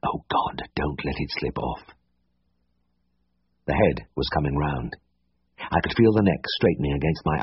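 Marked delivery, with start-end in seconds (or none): 0.0-1.8 whispered prayer under the breath; to himself. A narrator's reading. Vet whispering a prayer to himself mid-calving. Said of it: "And to myself".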